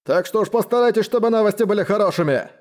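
high-pitched, irritated demand; barked warning. A narrator's reading. Hungover commander demanding good news, barking. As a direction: pitch it up into an impatient bark. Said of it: "рявкнул он".

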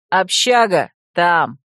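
loud one-word directions; calling out. A narrator's reading. Fox boy pointing out the dorm, calling out. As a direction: shout the way to someone. Calls out the way to her.

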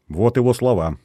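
plain reading voice

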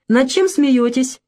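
jealous curiosity. A narrator's reading. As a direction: ask slowly, mildly jealous of their fun. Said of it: "ревниво поинтересовался Писа". (ревнивое любопытство, слегка)

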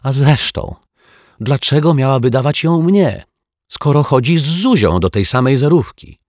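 put-on high, loud childlike protest; indignant argument. A narrator's reading. Dominik defends himself against his sister, indignant.

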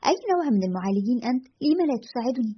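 ordinary delivery, nothing marked

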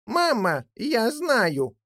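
put-on young jackdaw. A narrator's reading. Young jackdaw calling to mother from behind the door, piping up. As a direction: pipe up like a little one. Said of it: "сказал за дверью Голчонок".

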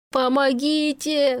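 drawn-out call for help; loud cry for help. A narrator's reading. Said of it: "заревел он во весь голос". (громкий крик о помощи)

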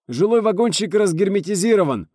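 high, loud emergency report; shouted report. A narrator's reading. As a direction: report the damage urgently by radio. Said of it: "доложил Кудряшов". (громкий срочный доклад)

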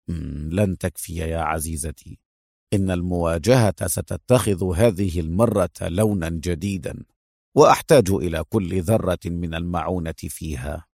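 calm and low. A narrator's reading. Hero calmly cutting off his ally, low and unhurried. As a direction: speak low and steady, calming her. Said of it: "قاطعها في هدوء".

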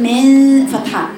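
من drawn out slowly with the jaw open; slow mock mistake. Shown as a demonstration. Teacher mimicking the student's open-jaw من slowly. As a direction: drag out the wrong open من slowly. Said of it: "الخطأ اللي أنت بتقولي من".

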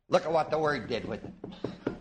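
ordinary delivery

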